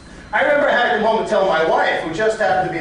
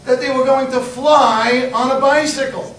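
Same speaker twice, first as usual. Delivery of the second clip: usual speaking voice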